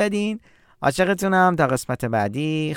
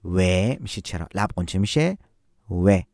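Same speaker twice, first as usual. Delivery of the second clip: usual speaking voice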